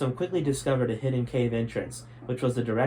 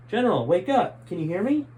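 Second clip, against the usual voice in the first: raised, higher call to wake him; calling out over the water to the general; urgent call; 7 semitones higher, 4 dB louder than usual. Acted. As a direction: shout him awake.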